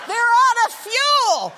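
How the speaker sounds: high, loud, drawn-out mock scream; imitated screaming panic; panicked shriek. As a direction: shriek it like a hysterical passenger. Panicked passenger acted after hushed pilot.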